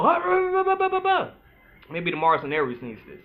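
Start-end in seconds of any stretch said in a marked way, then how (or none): 0.0-1.3 high, loud mock ranting huffs; a yelling man, sent up; mock yelling noise. Mocking a yelling man to the audience, high mock huffs. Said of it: "not gonna yell".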